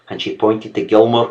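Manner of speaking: plain reading voice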